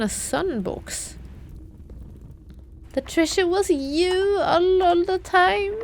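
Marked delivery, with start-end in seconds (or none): 2.9-5.7 mock tearful. Sentimental joke to chat.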